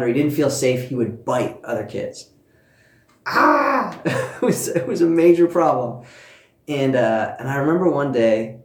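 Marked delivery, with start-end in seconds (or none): 3.3-4.0 laughing exclamation. Real, not acted. Child bit other kids, laughing. 4.0-6.0 laughing through the words; chuckling at the memory. Real, not acted. Mother laughing while telling the congregation about the biting.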